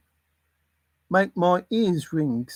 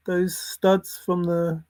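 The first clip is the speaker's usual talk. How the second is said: ordinary delivery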